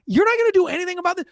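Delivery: high, fast disbelieving challenge; fast incredulous complaint to a parked cop. Retold or imitated. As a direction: call out the idle cop in disbelief. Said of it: "I'm like".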